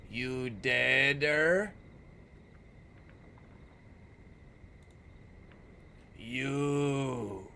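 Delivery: slow, drawn-out ghoulish rasp; creepy ghoul voice. Acted. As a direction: drool over each word like a starving ghoul. Voicing a diseased beggar.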